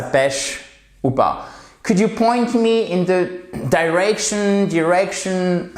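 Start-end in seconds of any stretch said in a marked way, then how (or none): 1.8-5.7 higher, the two versions of direction back to back; two pronunciations. Shown as a demonstration. Teacher offering learners both pronunciations, higher. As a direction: give both ways of saying direction. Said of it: "C'est comme vous voulez".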